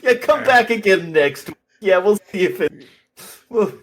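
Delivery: high, loud victory jeering; gloating taunt. Acted. Oswald jeering at the player he just beat, gloating. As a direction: rub it in through laughter. Acted gloating at beaten opponent.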